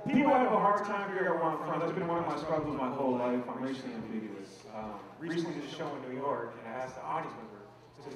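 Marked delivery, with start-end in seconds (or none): none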